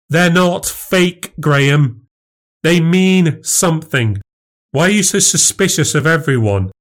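exasperated insistence. Acted. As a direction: spell it out for your husband. Wife pleads in quarrel.

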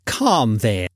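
lightly voiced cheerful nephew; gentle, good-humoured protest; a touch of gaiety; gaily. A narrator's reading. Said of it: "returned the nephew gaily".

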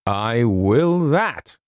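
slow, hearty Scots reassurance; drawn-out jolly confidence; a broad jovial Scot brushing it off. Acted. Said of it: "said Mister McKinnon jovially".

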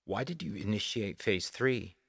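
quiet, restrained demand; understated demanding question. A narrator's reading. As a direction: softly demand an explanation. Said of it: "Organ demanded".

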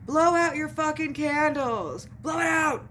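high mock shout from a distance; imitated yelling. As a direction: imitate a rowdy table yelling across the room. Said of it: "people were yelling".

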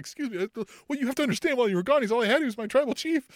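high-pitched mock-wounded excuse; imitating hurt self-justification; Paul Heyman, sad plea. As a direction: plead high, as if after a breakup. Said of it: "he's, like, sad".

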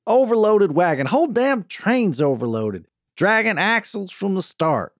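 loud, high Western griping; angry complaint. A narrator's reading. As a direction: argue back hotly, hammering each complaint. Quarrel over the overloaded wagon.

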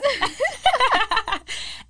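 high, loud laugh. Real, not acted. Friend calls her influencer, she bursts out laughing. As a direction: crack up at the tease.

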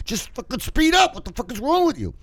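high, strained mock yell; pissed. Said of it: "getting fucking even more pissed".

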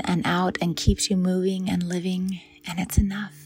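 whisper. Acted. Softly spoken meditation cue.

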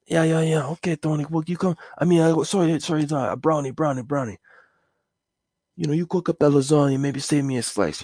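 high New York mobster put-on; a wiseguy asking for lasagna. Mobster asking neighbor for lasagna, wiseguy voice.

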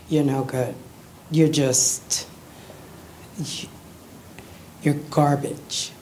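low, mildly scornful voice, dragging slowly; lightly voiced self-judgment, put down in a low tone; harsh inner critic. Recalling her lifelong inner voice, a low mild scorn. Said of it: "it was just a constant".

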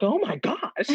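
high, laughing oh my gosh; real giddy laugh at her own success; laughing in surprise. Real, not acted. To the host about her first video going viral, laughing in surprise.

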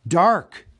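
quick confident answer. Acted. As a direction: call the answer straight out. Bilbo answers the riddle at once.

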